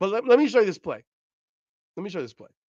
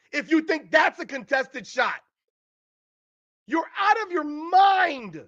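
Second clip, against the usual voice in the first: slow, higher, shouted outrage; furious shout; 5 semitones higher than usual. Real, not acted. To critics, furious slow shouting while replaying the shot. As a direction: hammer every word in disbelief.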